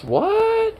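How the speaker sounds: high amazed what; surprised. Real, not acted. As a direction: squeak in disbelief. Reads the tape label.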